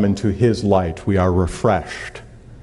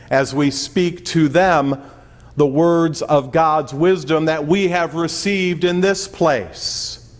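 preaching; 5 semitones higher than usual. Acted. Preaching at full force.

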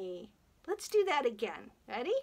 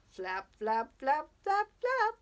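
flaps climbing higher and higher; rising flaps; 6 semitones higher, 4 dB louder than usual. Pretend. Storyteller acting out a dinosaur flying away, flaps rising high. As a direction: lift the pitch with each flap, as if taking off.

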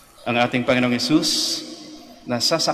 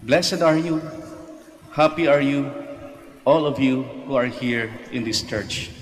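raised, ringing proclamation; proclaiming scripture; 3 semitones higher than usual. Acted. Priest reading the Beatitudes aloud to the faithful, raised. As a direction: lift the blessing up over the pews.

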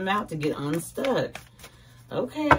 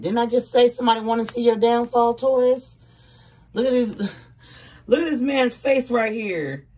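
louder, higher, excited; 6 semitones higher, 6 dB louder than usual. Real, not acted. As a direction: raise it up in triumph.

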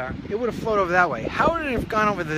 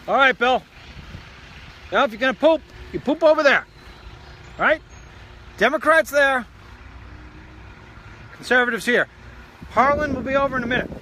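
loud and higher, bossing the ducks around; calling out to ducks; 7 semitones higher, 3 dB louder than usual. Real, not acted. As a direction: call across the yard to the ducks. Calling to ducks, giving orders.

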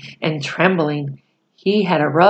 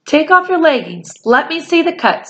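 put-on higher, urgent young voice; worried boy; 9 semitones higher than usual. A narrator's reading. Boy asking the Indian to show his wound, high and urgent. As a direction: take charge though scared. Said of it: "said Omri".

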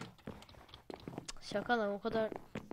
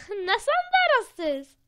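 higher, slow and louder teasing; mock teasing; 10 semitones higher, 12 dB louder, much slower than usual. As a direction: draw it out to tease the viewers. (izleyicilere alaycı, uzatarak)

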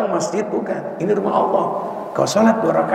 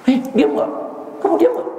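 imitated high-pitched demand for silence; mock scolding, pitch climbing sharply; emotional preacher. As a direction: act out a khatib losing patience with noisy children. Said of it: "Sampai khatibnya emosi".

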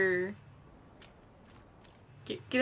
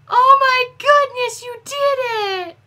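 much higher, slow doting dog-praise voice; talking to a dog; 9 semitones higher, 3 dB louder than usual. Acted. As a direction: gush at him like praising a good puppy. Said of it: "I'm talking to him like he's a frickin' dog".

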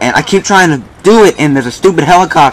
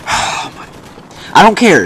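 sighing; 4 semitones higher, 4 dB quieter, much slower than usual. Real, not acted. Frustrated gamer gives up.

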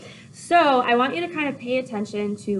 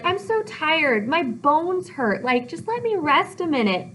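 complaining nurse; 7 semitones higher than usual. Acted. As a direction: whine as if utterly worn out. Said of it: "so she's complaining".

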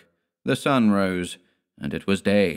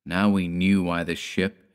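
usual speaking voice

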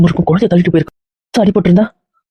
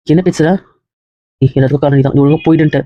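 usual speaking voice

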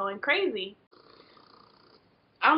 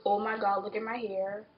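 ordinary delivery

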